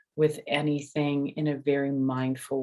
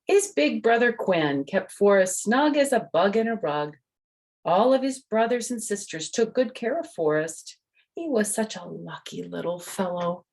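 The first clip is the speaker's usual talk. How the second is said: lively, raised storybook voice; reading to children; 3 semitones higher than usual. A narrator's reading. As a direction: tell it like a bedtime story for little ones. Reads the picture book aloud.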